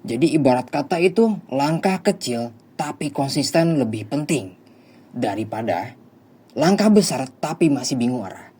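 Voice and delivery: loud, forceful life-coach preaching; motivational speech. Acted. Counselor delivers a motivational lecture.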